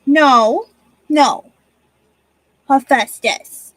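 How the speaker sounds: loud firm no, name drawn out slowly; firm scolding. Real, not acted. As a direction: stop the cat from taking the yarn. Scolding cat for stealing yarn.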